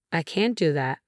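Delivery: ordinary delivery, nothing marked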